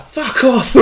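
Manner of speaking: high, loud, mimicked telling-off; hostile retort. Old woman telling a waiting driver where to go, mock angry yell. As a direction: imitate an angry pedestrian's outburst. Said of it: "gives me a face like".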